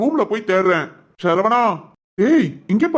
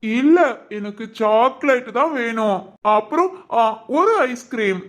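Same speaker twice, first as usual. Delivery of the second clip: put-on higher, innocent childlike voice; childlike man; 7 semitones higher than usual. Regressed son to his caretakers, higher childlike voice. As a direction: talk like a puzzled ten-year-old.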